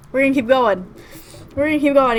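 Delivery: high, loud, overwhelmed; forced resolve. Real, not acted. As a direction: push on loudly while upset. Pushing on through emotional game.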